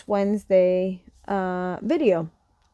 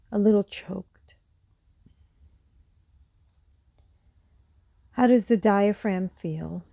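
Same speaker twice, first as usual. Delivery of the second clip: calm, unhurried guide with long pauses; guided meditation; 5 semitones lower, 4 dB quieter than usual. Real, not acted. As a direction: guide them gently through a body scan, softening as each phrase ends. Leads a seated meditation.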